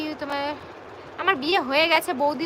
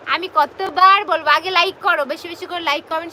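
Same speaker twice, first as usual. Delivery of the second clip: loud insistence; 3 semitones higher, 10 dB louder than usual. Real, not acted. Urging viewers to like first.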